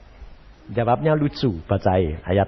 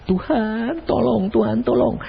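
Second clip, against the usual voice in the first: mock plea; 5 semitones higher than usual. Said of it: "lalu kamu berdoa".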